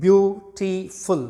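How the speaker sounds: slowed syllables. Shown as a demonstration. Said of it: "three syllables".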